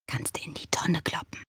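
conspiratorial whisper; whispered aside. Acted. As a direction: whisper it behind your hand. Snide aside to listener.

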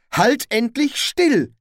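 high, loud, drawn-out order; exasperated command. Acted. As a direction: order someone sharply to hold still. Carrot struggles with stuck helmet. (genervter, lauter Befehl)

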